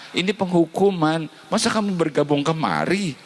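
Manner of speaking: very high mocking scold; high-pitched mock rebuke from the ancestors. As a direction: berate the foolish newcomer. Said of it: "Mereka bilang begini".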